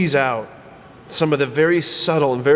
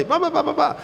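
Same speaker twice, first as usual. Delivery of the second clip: mock gossip babble; 10 semitones higher, faster than usual. Mocking endless chatter.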